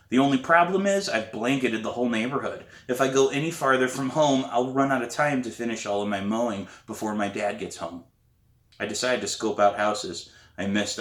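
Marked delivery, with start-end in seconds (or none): none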